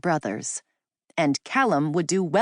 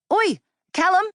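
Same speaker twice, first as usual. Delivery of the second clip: much higher, louder English shout to his brother; calling out; 5 dB louder than usual. Acted. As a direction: yell back into the truck for Callum.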